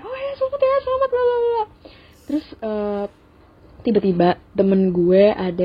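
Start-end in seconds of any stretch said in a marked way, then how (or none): 0.0-1.6 high, mock-bright congratulating; forced congratulations. Congratulating her friends, high and falsely bright. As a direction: chirp out the congratulations. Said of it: "Terus gue kayak".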